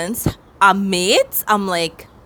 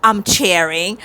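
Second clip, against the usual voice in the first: usual speaking voice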